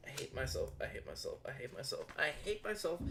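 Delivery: very low, quiet repeated mutter; barely audible self-reproach; self-loathing mutter. Real, not acted. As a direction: grumble it to yourself under your breath. Blames himself after losing page.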